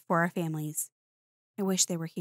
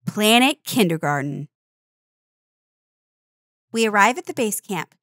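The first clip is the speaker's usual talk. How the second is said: ordinary delivery, nothing marked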